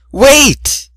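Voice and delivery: high loud cry; calling after him to stop; call wait. A narrator's reading.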